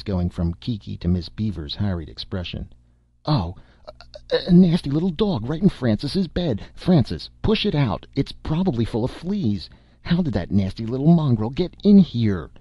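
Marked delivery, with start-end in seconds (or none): 3.3-12.5 high, stammering disgust at the dog; outraged mother. A narrator's reading. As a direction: sputter it, high-pitched and scandalised.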